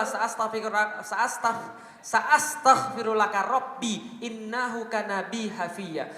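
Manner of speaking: slow, measured Arabic chant; Quranic recitation. Acted. Preacher reciting Abraham's words to his father, slow reverent chant. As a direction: recite the verse slowly and reverently.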